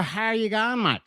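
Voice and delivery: broad Australian greeting with a lifted going and a flat mate; Australian accent. Pretend. Streamer greets Aussie viewers in a mock Australian accent. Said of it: "Give me a".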